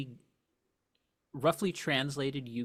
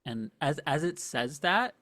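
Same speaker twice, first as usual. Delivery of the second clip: normal talking voice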